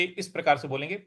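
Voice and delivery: normal talking voice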